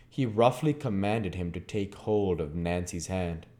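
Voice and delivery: ordinary delivery, nothing marked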